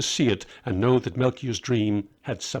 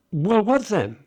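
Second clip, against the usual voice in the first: eager; 9 semitones higher, 3 dB louder than usual. Acted. Eager question.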